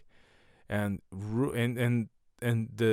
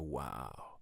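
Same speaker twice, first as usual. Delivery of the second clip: very low, hushed amazement; mock awe; 10 semitones lower, 13 dB quieter than usual. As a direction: breathe it low and soft, as if awestruck. Acts out awe at the name.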